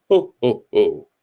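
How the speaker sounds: a hint of Santa's ho ho ho; stiff jolly laugh. A narrator's reading. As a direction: Death trying out the laugh again. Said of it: "What does she want for Hogswatch".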